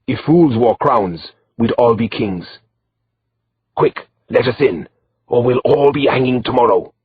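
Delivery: put-on the Moor, pressing in a broken foreign accent. A narrator's reading. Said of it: "In his broken accent".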